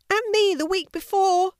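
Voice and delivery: very high, squealed chimp voice; complaining chimpanzee. A narrator's reading. Chimpanzee joining complaint about lion, high squeal. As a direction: chime in high and indignant.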